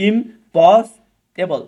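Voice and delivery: slow syllable split of ইমপসিবল. Shown as a demonstration. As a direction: stretch ইমপসিবল into its parts, slowly. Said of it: "তাহলে ইমপস".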